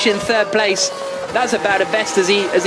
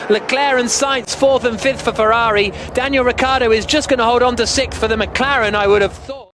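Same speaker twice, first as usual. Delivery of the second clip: ordinary talk, nothing marked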